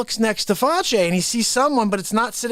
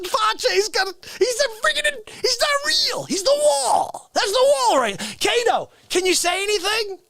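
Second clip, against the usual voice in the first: very high, straining comic rant; exasperated outburst. Acted. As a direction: cry out in mock disbelief, voice way up. Shouting that Kato is a wall.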